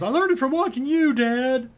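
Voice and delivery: put-on squeaky kid talking back; a son throwing his father's words back at him, in a high kid voice; kid's voice. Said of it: "finally the son goes".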